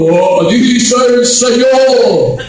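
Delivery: slow, loud, drawn-out pompous prophet tone; mock prophet voice. Mocking a false prophet to the church, pompous slow intoning. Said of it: "lo dicen en un tono".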